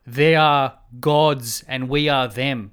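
loud, very slow alien proclamation; mock alien. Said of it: "they say it in their mad alien voice".

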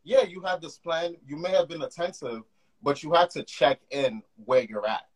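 ordinary talk, nothing marked

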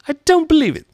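very high, loud bird voice; put-on piercing dismissal; disbelieving pigeon. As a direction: screech a flat refusal to believe. Pigeon rejects Alice's claim.

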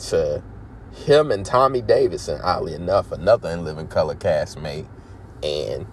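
ordinary talk, nothing marked

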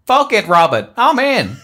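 loud, decisive American pilot; hammed-up action-hero commitment; defiant acceptance. Retired pilot giving in to his commander, defiant acceptance.

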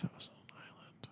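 hushed secretive whisper. Real, not acted. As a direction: lean in and whisper it. Conspiratorial aside about island.

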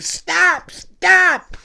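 high-pitched helpless old lady, mock cries. Old lady calling to her attacking dog, high mock pleading. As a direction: imitate an old lady feebly trying to call off her dog. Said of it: "the lady was old. She couldn't do nothing".

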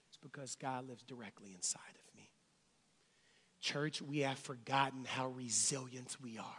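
low, soft sermon voice; soft, hushed words of assurance to a congregation; hushed preaching. Acted. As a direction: preach it quietly and low, almost under the breath.

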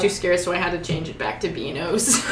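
ordinary talk

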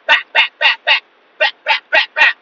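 loud, high rapid gunshot noises; imitated gunfire. Storyteller firing at raccoons, loud imitated gunfire. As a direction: bark out shots in bursts.

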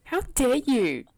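high, loud, drawn-out indignation; indignant comeback. Real, not acted. Accused friend throws it back.

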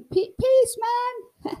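high-pitched stoner voice; stoned hippie. Retold or imitated. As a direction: float it up like a blissed-out hippie. Imitating being high.

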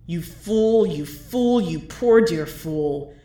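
a mocking presence hissing into his ear; menacing intruding voice. Intruding voice taunts the letter writer.

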